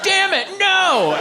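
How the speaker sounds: high, drawn-out cry of dismay; hammed-up alarmed scolding; mock panicked yell. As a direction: yell in comic horror to stop it. Panicked yell at puking cat.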